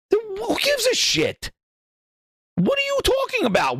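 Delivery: high, sharp exasperated questions; outraged disbelief. Real, not acted. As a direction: push the pitch up in outrage.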